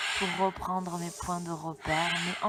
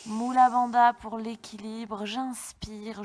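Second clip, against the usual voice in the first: yoga instructor; 5 dB louder than usual. Acted. Yoga breathing class instructions.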